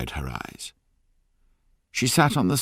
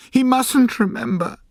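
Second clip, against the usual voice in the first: high, loud anguished plea, much higher; crying out; 7 dB louder than usual. A narrator's reading. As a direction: cry it out in a high, desperate voice. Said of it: "she cried".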